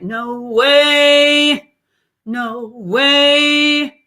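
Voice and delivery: twangy sung "no way", loud; nasal "no way". Shown as a demonstration. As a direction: push "no way" into the nose. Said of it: "Nasality shifts".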